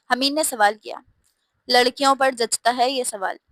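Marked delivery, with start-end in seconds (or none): none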